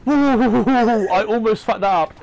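slow, loud relieved gasp of words; relieved exclaim. Real, not acted. Near miss, relieved slow exclaim.

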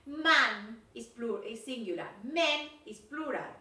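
"Man" and "men" drawn out slowly to contrast the vowels; teacher contrasting "Man" and "men" carefully; slow pronunciation model. Shown as a demonstration. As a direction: stretch "men" to show the plural sound. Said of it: "ojo de pronunciation".

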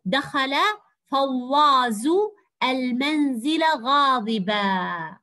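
very slow reading, غاضبا ending in a long open "aa"; stretched model reading with the n of غاضبا dropped; alif ending, no tanween. Shown as a demonstration. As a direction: read word by word and close غاضبا as "ghadibaa", without n. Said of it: "إذا قرأناها بدون تنوين، قرأناها ألف".

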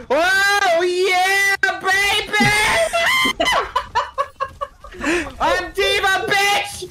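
hyped shout. Real, not acted. Gamer hyped in voice chat.

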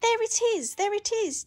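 put-on excited young knight calling out. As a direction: point it out eagerly to your steed. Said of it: "Danny called to his steed".

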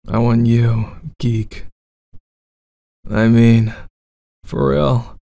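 bad boy roleplay.

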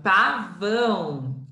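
"pavão" stretched out slowly; slow reading. Shown as a demonstration. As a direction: read the word aloud carefully. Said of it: "teacher reads word slowly".

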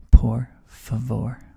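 por favor said slow and loud, stress on por; teacher demo, slow. Shown as a demonstration. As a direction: say por favor slowly, leaning on por. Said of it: "Does anybody in class know what that means?".

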